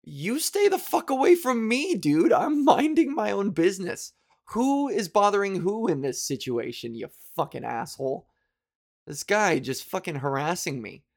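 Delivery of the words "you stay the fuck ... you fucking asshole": higher, indignant mock yell; retold comeback. Retelling her answer to the harasser, indignant. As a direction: snap back, half-hearted. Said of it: "And I was like".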